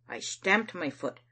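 ordinary delivery, nothing marked

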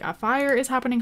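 ordinary delivery

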